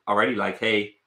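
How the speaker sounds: ordinary delivery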